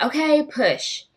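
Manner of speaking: coaching nurse. Retold or imitated. Staff coaching her to push.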